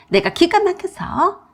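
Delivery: can't believe your ears; outraged disbelief. Acted. Sister-in-law cries out in outraged disbelief.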